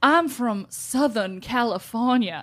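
slow Southern drawl; imitated Southern accent. Mom answering the guy at the counter, imitated Southern accent. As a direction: stretch the words into mock Southern. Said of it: "with a Southern accent".

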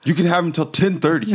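high-pitched put-on dad voice; Southern dad. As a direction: answer as the playacted Texan dad. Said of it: "playing the dad was really fun".

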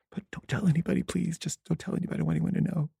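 high, hushed, put-on weepy plea; feigned tearful pleading. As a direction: beg softly as if crying. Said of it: "I'm crying and I'm crying".

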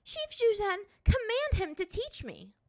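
high, loud, furious command; angry. A narrator's reading. Azula demanding the chief intervene, angry. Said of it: "starting to get angry".

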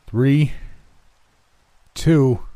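high, spaced-out count; yelled countdown. A narrator's reading. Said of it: "my dad yelled".

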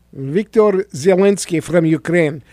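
put-on Russian accent; Putin. Radio host playing Putin coveting Ukraine, mock Russian accent. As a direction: talk like a mock Russian strongman in a thick accent. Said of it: "with same accent as Mister Putin".

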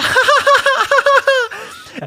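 real burst of high laughter; high cackle. Real, not acted. Storyteller laughing at his own driver joke, high cackle. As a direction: crack up laughing.

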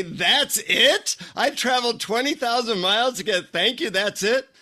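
comic "that's all I get?" outrage; mock disbelief. Acts out his disappointed disbelief.

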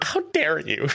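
high, hammed-up indignation; mock outrage. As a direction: play the offended diva. Echoing the joke quote.

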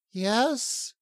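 high-pitched questioning reply; high-voiced Lala, attentive. Lala listens to Rin's instructions, high and questioning. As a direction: answer in a small high questioning voice.

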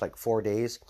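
ordinary talk, nothing marked

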